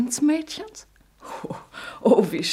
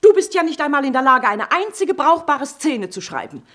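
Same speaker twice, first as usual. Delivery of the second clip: loud, higher, fast angry retort; mother shouting back at her son; furious shout; 4 semitones higher, 6 dB louder than usual. Acted. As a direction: hit back hard and fast.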